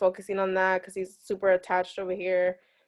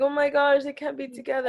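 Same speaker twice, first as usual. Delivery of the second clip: mock dramatic lovers; 6 semitones higher than usual. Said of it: "they're like".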